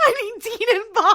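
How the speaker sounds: loud, high laughing exclamation; real laughter pushing the pitch way up; laughing outburst. Real, not acted. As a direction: burst out laughing through the words. Laughing echo of co-host's line.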